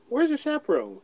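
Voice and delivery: high put-on voice to a little girl; squeaky mock-creepy voice calling her little girl. Man on the ride talking to a girl in a squeaky put-on voice. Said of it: "why are you talking like this?".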